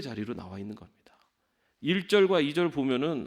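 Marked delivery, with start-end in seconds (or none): 0.0-1.0 soft unvoiced whisper. Real, not acted. Preacher hushing to tell the congregation about prayer, whispered. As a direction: drop to a hush on the point.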